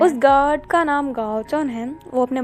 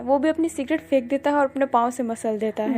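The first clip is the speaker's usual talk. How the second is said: usual speaking voice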